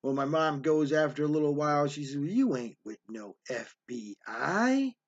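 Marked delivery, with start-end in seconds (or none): 2.2-5.0 low, slow, drawn-out disbelief; unhurried, scornful doubt; skeptical challenge. Retold or imitated. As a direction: imitate a mother calling a bluff. Mother calls the agent's bluff.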